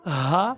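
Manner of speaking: low breathy ہا; guttural ہا demo; throat sound. Shown as a demonstration. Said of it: "ہا کی".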